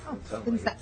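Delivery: ordinary talk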